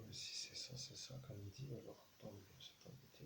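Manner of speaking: very soft, sinking almost to a whisper; hushed aside. Real, not acted. Medium closing an invocation to himself, hushed aside. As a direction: let it fade away under your breath.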